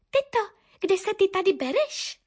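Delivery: high questioning chick voice; curious little bird. A narrator's reading. Youngest Korinka questions her aunt, high curious voice. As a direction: ask like an inquisitive little niece. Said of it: "vyptávala se nejmladší Korinka".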